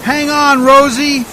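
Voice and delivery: loud shout over the roaring storm; desperate yell to hold on; urgent shout. Acted. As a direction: bellow it so she hears you through the wind. Boat sinking in storm.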